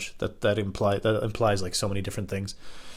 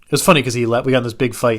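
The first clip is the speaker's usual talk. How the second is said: ordinary talk, nothing marked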